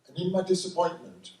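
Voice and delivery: usual speaking voice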